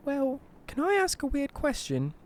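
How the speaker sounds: put-on high-pitched English old-lady voice; sweet English granny, pitched high. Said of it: "She's originally from England".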